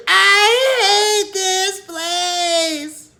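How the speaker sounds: very high, very loud, stretched scream; boy screaming as he runs up the stairs. A narrator's reading. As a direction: wail it while running upstairs. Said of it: "he runs screaming up the stairs".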